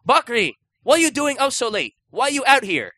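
high-pitched mock scolding as an old shopkeeper; old Chinese shopkeeper. Shopkeeper scolding customer out late, hammed-up shouting. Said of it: "an old Chinese man".

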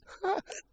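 a giggle cut by a hiccup; hiccupy laugh burst. Real, not acted. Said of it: "Is that a hiccup?".

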